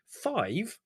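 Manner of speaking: high startled question; startled, voice jumps up. Acted. Leslie, startled by the number: a high surprised Five?. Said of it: "said Leslie, startled".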